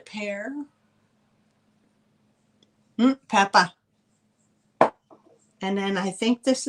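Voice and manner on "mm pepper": "pepper" said as New York "Peppa", r dropped, louder; New York accent. Shown as a demonstration. Seller showing viewers her New York "Peppa", playful accent. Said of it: "When I say Peppa, you know I'm from New York".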